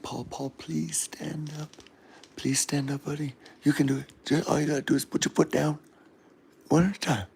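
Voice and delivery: soft, half-whispered pleading; very quiet mock screaming; hushed coaxing. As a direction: gently talk a buddy through it under your breath.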